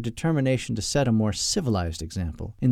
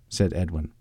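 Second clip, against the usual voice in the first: ordinary delivery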